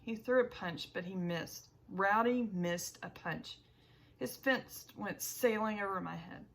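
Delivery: plain reading voice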